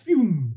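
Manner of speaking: high "phew" whoosh, falling away; quick falling zoom noise. Acted. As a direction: imitate something zipping straight past. Imitating boat shooting past warships.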